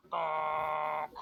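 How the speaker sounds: flat robotic bonk. Acted. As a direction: say it like a machine. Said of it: "you just hear a robotic voice go".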